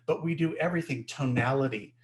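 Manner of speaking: ordinary talk, nothing marked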